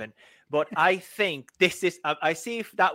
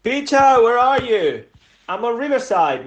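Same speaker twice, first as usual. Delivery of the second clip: calling out; 6 semitones higher, 10 dB louder than usual. Acted. Calling out loudly.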